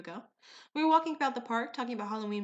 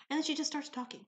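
usual speaking voice

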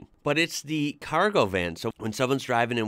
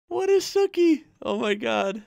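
high-pitched, amused bafflement; laughing disbelief; 13 semitones higher, 3 dB louder than usual. Real, not acted. Reader baffled by Aussie slang, laughing in disbelief. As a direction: squeal it through leftover laughter.

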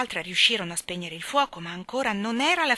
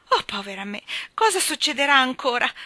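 raised, higher cry of dismay; 6 semitones higher, 7 dB louder than usual. A narrator's reading. Mrs Banks crying out at yet another disaster, crying out. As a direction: call it out loud and high. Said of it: "gridò missis Banks".

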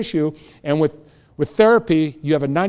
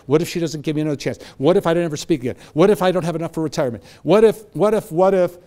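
ordinary delivery

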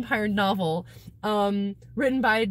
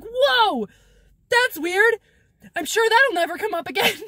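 high, loud fake amazement; hammed-up wide-eyed surprise; mock surprise; 9 semitones higher, 4 dB louder than usual. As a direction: gush like it means nothing at all. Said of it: "And he's like".

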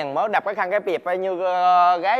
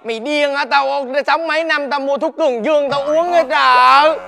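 loud bickering; 5 semitones higher, 7 dB louder than usual. Acted. Master argues with disciple.

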